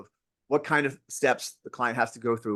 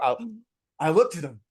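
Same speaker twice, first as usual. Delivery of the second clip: ordinary talk, nothing marked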